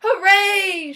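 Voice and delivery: loud crowd cheer; a big joyful cheer; shouted hooray. Acted. The crowd cheering Lyle, loud and joyful. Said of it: "shouted the crowd".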